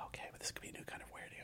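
soft whisper, slowing down. Retold or imitated. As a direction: whisper like at a hushed concert. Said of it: "Why are we whispering?".

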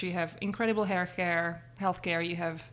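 ordinary talk

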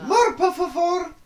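high squeaky begging, laughing; orphan in bad Spanish. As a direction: ham up a hungry orphan in Spanish. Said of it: "it's not Spanish".

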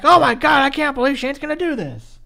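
high-pitched mock commentator shock; mock astonishment. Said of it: "so surprised".

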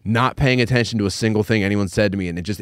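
usual speaking voice

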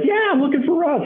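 very high eager reply; overexcited caller. Retold or imitated. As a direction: beam with excitement. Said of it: "He's like".

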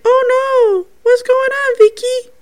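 put-on very high, loud little-boy voice; imitating Jacob. Showing viewers the spirit boy's words, high imitation. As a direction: mimic a distressed child far up in pitch. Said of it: "It's like".

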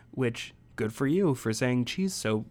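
ordinary talk

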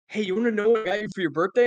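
can't hold the secret in, rush it; eager blurt. Retold or imitated. Retelling blurting out present.

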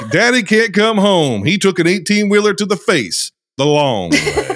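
put-on lively Southern-accented storyteller reading an intro. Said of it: "intro here in this accent".

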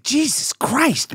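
high, slow, stretched disbelief; exasperated amazement. Retold or imitated. Guest reacting to the toasts, exasperated amazement.

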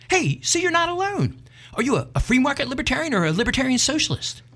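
woman's voice far up, eager; high eager woman. Acted. Woman on bridge quizzes the man, high and eager. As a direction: quiz him eagerly in a high voice. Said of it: "she exclaimed".

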